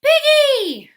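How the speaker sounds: high, loud cry of a name; frantic shout. Acted. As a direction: yell for help in a panic. Gerald calls his friend.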